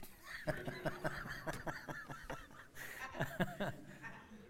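soft airy laugh; a quiet amused laugh; breathy laugh. Real, not acted. Laughs after aside.

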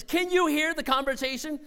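ordinary delivery

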